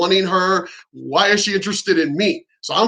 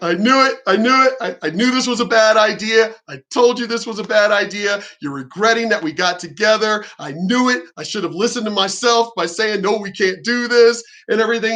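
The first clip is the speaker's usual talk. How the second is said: higher-pitched, agitated self-reproach; retold freak-out; 4 semitones higher than usual. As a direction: replay a panicked "I knew it" outburst. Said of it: "And I'm freaking out".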